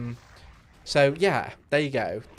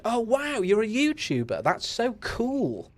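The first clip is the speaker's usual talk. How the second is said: mock gushing fan; 11 semitones higher than usual. Mocking fake fans who now admire.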